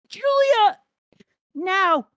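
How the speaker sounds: high, loud call to an ally; full-voiced, high-pitched cry; urgent battle shout. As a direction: yell the signal across a battlefield. Character calls out in battle.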